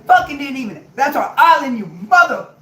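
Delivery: high, loud screaming tirade; shrieking high-pitched cursing rant; furious wife yelling. Retold or imitated. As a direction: scream it at him like a betrayed wife. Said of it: "one said".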